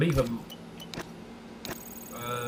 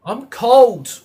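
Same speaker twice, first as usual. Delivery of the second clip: loud, higher complaining shout; 8 semitones higher, 14 dB louder than usual. Real, not acted. Gamer complaining he is freezing, loud shout. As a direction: yell the complaint across the room.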